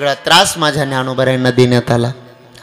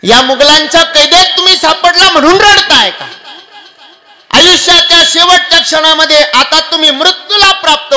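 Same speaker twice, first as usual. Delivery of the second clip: high, loud anxious asking; pleading question; 13 semitones higher, 6 dB louder than usual. Acted. Poet Bhushan asking weeping Sambhaji, loud pleading question. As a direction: beg him to say why. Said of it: "तो महाराजांना विचारतो".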